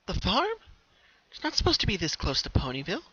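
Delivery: high, bright pony voice, startled; a filly voice caught off guard; surprised Pinky. A narrator's reading. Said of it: "she thought".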